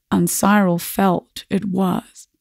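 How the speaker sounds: ordinary delivery, nothing marked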